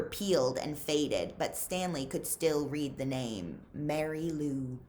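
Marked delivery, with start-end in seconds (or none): none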